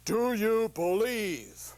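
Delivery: very slow, solemn divine question; God asking. Acted. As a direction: ask it slowly from on high. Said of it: "And God says".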